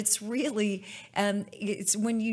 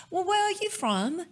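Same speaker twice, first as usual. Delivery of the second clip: English lady voice; 11 semitones higher than usual. Retold or imitated. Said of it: "she was from England".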